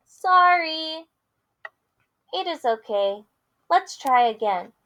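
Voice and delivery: lively picture-book read-aloud with character voices; reading to children. Acted. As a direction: read it big for the kids.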